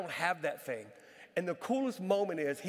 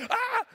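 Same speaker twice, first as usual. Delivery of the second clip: high, loud mock yelp; startled yelp; 6 dB louder than usual. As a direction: yelp as if horrified by what you hold. Startled on seeing head in hand.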